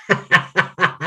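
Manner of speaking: lion laughter demo. Shown as a demonstration. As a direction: laugh with tongue on chin, paws up. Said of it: "I'll demonstrate it here".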